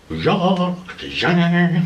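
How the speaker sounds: high mock creature shriek; imitated shrieking. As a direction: shriek like a charging mob. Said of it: "but they sounded like".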